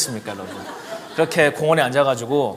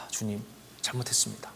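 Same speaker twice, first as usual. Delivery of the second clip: soft repentance; 5 semitones lower, 13 dB quieter than usual. Retold or imitated. Repenting prayer, retold.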